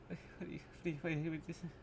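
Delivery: whispered, repeating stammer; acted panicked stammer. Acting out facing a gunman, hyperventilating panic. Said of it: "I'd, like, be hyperventilating".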